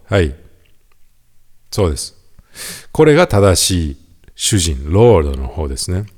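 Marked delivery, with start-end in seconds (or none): none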